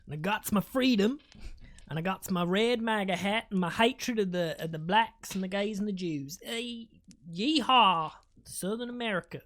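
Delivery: hammed-up Deep South parody; Southern American accent. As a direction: rattle off a list like a caricatured Southerner. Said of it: "that was a parody of the Deep South".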